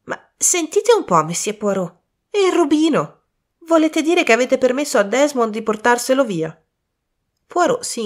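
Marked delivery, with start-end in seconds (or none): 0.0-6.6 put-on high-pitched, puzzled boyish protest; perplexed. A narrator's reading. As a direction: press Poirot in bewildered disbelief. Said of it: "c'era un'espressione perplessa". (perplesso e incredulo, voce acuta)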